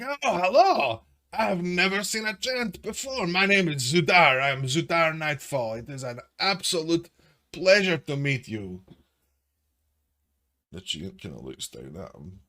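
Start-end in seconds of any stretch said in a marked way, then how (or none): 0.0-8.8 show-off hobgoblin. Acted. Zudar greeting the treant grandly, high show-off voice. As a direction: introduce yourself like a show-off. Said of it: "he's a show-off kinda thing".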